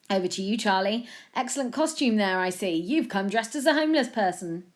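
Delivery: emphatic, projected news-anchor delivery; pompous studio presenter handing over to a reporter; TV news presenter, self-important. A narrator's reading. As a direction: present a silly bulletin with full seriousness.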